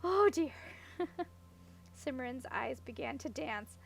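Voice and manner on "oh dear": laughing. A narrator's reading. Still laughing at the joke.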